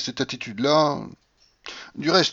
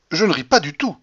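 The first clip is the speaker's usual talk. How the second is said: higher, louder offended retort; indignant; 5 semitones higher, 5 dB louder than usual. A narrator's reading. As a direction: snap back with indignation. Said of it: "dit-elle avec indignation".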